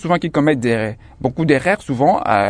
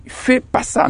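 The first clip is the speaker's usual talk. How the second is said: higher, breathy mock of «fais pas ça»; wrong form quoted; 10 semitones higher, slower than usual. Shown as a demonstration. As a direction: flick off «fais pas ça» as the lazy version. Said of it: "quand on dit". (forme fautive, aiguë et soufflée)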